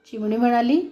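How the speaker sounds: plain reading voice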